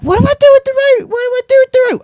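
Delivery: very high, fast mock-panicked old lady; panicky old lady squeak. Old lady in the burning building squeaks for instructions, panicky. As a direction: squeal it, rushed and helpless. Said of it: "she's like".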